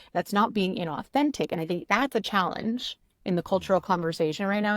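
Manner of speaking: ordinary talk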